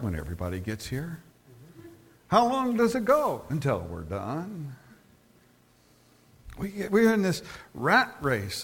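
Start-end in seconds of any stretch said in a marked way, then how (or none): 0.0-1.2 dropped low and soft; laid-back answer. Acted. Local answering when service starts, laid-back. 2.3-3.4 high-pitched question; inquiring visitor. Acted. Visitor asking how long the service goes, inquiring. 3.5-4.7 low, slow and soft; laid-back answer. Acted. Local answering how long service goes, laid-back.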